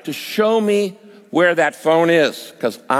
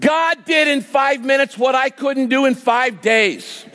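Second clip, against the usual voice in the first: triumphant exclamation; 8 semitones higher than usual. Real, not acted. Triumphant punchline of story.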